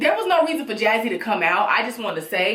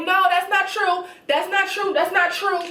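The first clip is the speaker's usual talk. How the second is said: higher-pitched mock protest; retold denial; 7 semitones higher than usual. Mia denying it to the whole table, mock protest. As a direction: insist on it in a higher retelling voice. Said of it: "Mia was like".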